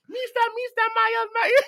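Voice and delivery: very high mimicked radio call; high, laughing imitation of a caller; Julie. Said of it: "how would Julie call you on the radio".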